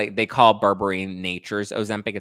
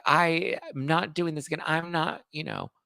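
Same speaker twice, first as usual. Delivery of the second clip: lightly voiced higher-pitched refusal; mildly mocking, raised-pitch protest; imitated protest; 7 semitones higher than usual. Host voicing a reality star's refusal, lightly imitated protest. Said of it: "And she's just like".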